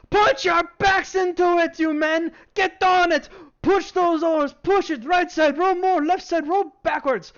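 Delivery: high, loud slow shouted orders; harsh barking commands. Acted. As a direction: bark orders at the rowers from the deck. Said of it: "shout out".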